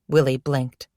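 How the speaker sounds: usual speaking voice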